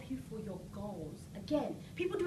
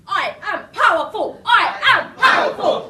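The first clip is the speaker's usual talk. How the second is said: high, slow, shouted chant; booming motivational speech; 7 semitones higher, 20 dB louder than usual. Shown as a demonstration. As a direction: chant it with rising energy. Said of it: "now we're gonna add some energy".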